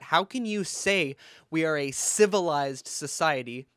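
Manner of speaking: normal talking voice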